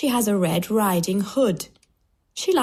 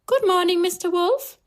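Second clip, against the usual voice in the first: put-on very high little-girl greeting; high, innocent storybook girl; 11 semitones higher than usual. A narrator's reading. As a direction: greet the wolf in a high child's voice.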